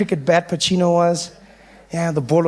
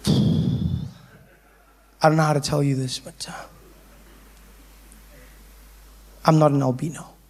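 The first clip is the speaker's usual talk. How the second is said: low, slow, reluctant admission; hesitant confession; 5 semitones lower, 3 dB quieter than usual. Acted. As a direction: trail off, then confess it low. Hesitant confession to his friends.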